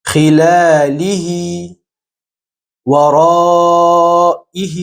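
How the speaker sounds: drawn-out chanted Arabic words, one by one; slow Quran recitation. Shown as a demonstration. Teacher recites Quranic example words in a slow chant. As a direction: chant each word slowly with the long ha.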